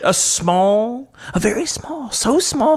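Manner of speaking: high, drawn out mock politician. Shown as a demonstration. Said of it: "Let's say it again".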